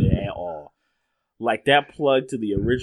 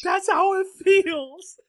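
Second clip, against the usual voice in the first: much higher, squealing Loki imitation; mock Loki scream; slower than usual. Friend imitates Loki screaming at Thor, high-pitched mock scream. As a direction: shriek it like a gleeful Loki. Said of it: "jumping up and down and screaming".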